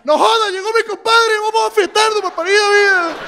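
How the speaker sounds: very high, giddy party dog voice; the dog cheering for its returning owner; talking dog. Retold or imitated. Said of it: "si el perro hablara humano, diría". (perro fiestero con voz muy aguda)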